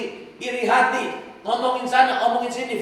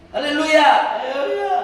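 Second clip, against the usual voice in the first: loud, high hallelujah, rising higher on the second; jubilant hallelujah; 7 semitones higher, 4 dB louder than usual. Real, not acted. Preacher celebrating the girl's voice returning, jubilant high cry. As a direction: burst out praising. Praising after the healing.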